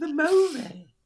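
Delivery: high, mocking whine; imitating whiny outburst. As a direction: mimic her indignant whine. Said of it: "The way you said that".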